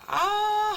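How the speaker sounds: high, loud open "ah"; yawn-like baby cry. Shown as a demonstration. Teacher shows sleepy baby's wide-mouthed sound, yawn-like cry. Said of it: "하고 입을 크게 벌리니까 하품할 것처럼".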